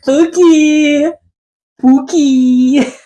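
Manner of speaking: high sing-song calling out; playful calling. Pretend. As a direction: call Pookie like a pet's name. Calling out the name.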